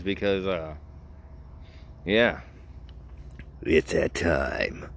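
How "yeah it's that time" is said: low, drawn-out announcer voice; mock-solemn announcement. Podcaster announces the segment has come, mock-solemn. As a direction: drop it down and stretch it slowly. Repeats announcement in put-on deep voice.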